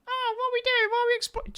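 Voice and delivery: a high, airy squeak mocking her; hammed-up falsetto of a shocked actress; mock Sydney Sweeney. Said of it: "Sydney Sweeney".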